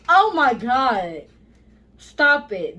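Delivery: ordinary talk, nothing marked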